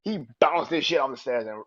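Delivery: ordinary talk, nothing marked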